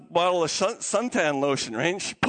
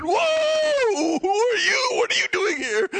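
high, loud mock fright; freaked out; 11 semitones higher, 5 dB louder, slower than usual. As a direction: play startled by a stranger, hammed-up. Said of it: "We'll freak Zachariah out".

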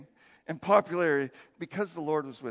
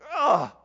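short loud frustrated "Ah"; a king's sudden outburst of jealous frustration; upset shout; 4 semitones higher, 9 dB louder than usual. Retold or imitated. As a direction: blurt it out like a fed-up king. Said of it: "so upset".

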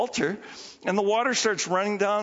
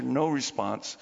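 normal talking voice

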